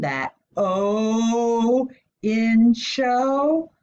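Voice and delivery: "O" held long and high, "Cho" rising; long O sound. Shown as a demonstration. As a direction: stretch "O" slowly, ask "Cho" on a rise. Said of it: "Do you hear the long O sound".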